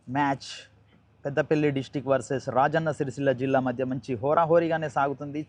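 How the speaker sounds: usual speaking voice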